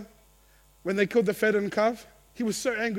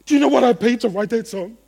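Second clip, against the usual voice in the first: indignant complaint; 10 dB louder than usual. Retold or imitated. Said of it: "it could look in the form of music".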